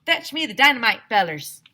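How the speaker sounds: loud southern prospector call; southern miner order. A narrator's reading. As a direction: bark the order down the hole. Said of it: "he told him".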